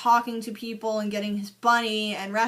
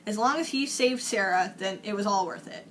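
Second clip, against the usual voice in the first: ordinary delivery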